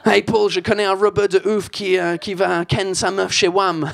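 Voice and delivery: put-on English accent in French slang. Comedian mocking verlan in an English accent. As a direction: lean on the English accent as a Brit. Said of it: "cet accent de merde".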